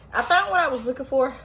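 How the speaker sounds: winded after searching; puffing, short of air; out of breath. Real, not acted. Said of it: "that really shows that I'm out of breath".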